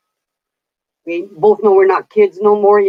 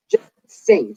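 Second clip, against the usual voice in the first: bossy order; 9 semitones higher than usual. Retold or imitated. Sister ordering her to sing.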